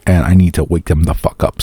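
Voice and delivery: normal talking voice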